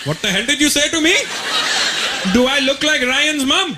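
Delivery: laughing through the father's fury; angry dad. Retold or imitated. Dad angered by son's insult.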